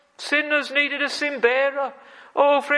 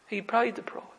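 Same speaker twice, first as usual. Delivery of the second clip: much softer repeat, falling away; soft echo; 2 semitones lower, 8 dB quieter than usual. Acted. Preacher to congregation, a hushed echo. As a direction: let it fade to a hush.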